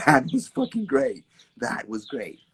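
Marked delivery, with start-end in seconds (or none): 0.1-1.2 high, loud self-praise; hammed-up high loud cheer; mock gushing. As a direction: gush over your own piece. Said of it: "no dancer does a piece and goes".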